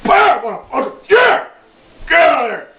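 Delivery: high, loud mock battle yell; high yelled war cry. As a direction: yell it while swinging the mop. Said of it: "I just fucking".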